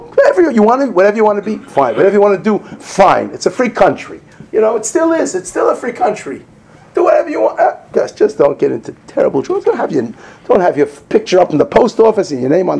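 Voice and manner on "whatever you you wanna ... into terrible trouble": loud, high, shrugging permissiveness; imitating a careless easygoing parent; mock indifferent parent. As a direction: toss it off like nothing matters. Voicing secular parent's attitude.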